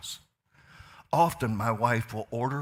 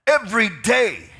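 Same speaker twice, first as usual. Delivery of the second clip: much higher and louder, half-sung preaching; preaching at full force; 8 semitones higher, 9 dB louder than usual. Acted. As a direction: build it into a singing preacher's cry.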